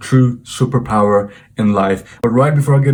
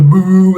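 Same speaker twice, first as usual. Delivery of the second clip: mock boo, higher and louder; imitated booing; 6 semitones higher, 5 dB louder than usual. Classmate booing him before the whole class, mock jeer. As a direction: give a drawn-out jeering boo. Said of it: "started booing me".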